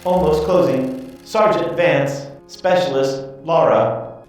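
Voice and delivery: high, halting machine voice; tinny robot voice. Acted. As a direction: report like a clockwork automaton. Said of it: "tinny voice".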